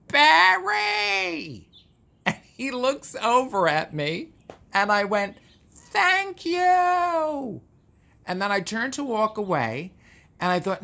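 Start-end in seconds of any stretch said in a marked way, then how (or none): 0.1-1.7 put-on high, loud Bronx-accented Barry; Bronx call. Calls out to Barry across the party in a Bronx accent. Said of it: "with my Bronx accent". 5.7-7.6 high, drawn-out Bronx thank you. Retold or imitated. Calls thanks to Barry across the room in a Bronx accent. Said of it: "with my Bronx accent".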